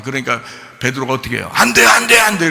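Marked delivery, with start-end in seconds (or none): 1.5-2.5 loud pleading no; mock cry of protest. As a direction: shout it like a panicked disciple. Peter protesting Jesus.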